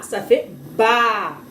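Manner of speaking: very slow, drawn-out la; la sounded out slowly; slow syllable demo. Shown as a demonstration. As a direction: stretch ça fait la for a child. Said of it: "B, A".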